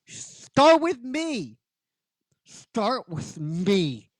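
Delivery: drawn-out strained plea, acted; very slow, desperate and short of breath; crying, breathless plea. Crying Ranger who can't breathe offers herself, strained and slow. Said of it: "She's crying".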